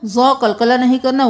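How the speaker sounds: ordinary talk, nothing marked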